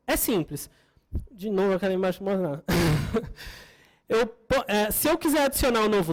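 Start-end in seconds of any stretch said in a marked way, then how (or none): 2.7-3.5 brief, low laugh to oneself; short chuckle. Real, not acted. Presenter amused at a slide not showing, short chuckle. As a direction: let out a small amused chuckle.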